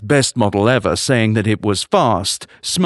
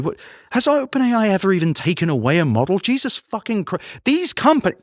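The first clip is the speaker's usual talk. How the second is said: high-pitched, incredulous ranting; exasperated outburst; 9 semitones higher than usual. Real, not acted. Podcast host ranting at an AI company, exasperated. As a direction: rant in rising disbelief until words give out. Host's rant at the company boils over.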